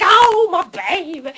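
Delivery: loud, high mock wail; over-the-top trolley driver. Trolley driver leaping from his trolley, hammed-up wail. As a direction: stretch out a hammed-up lament. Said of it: "he's like".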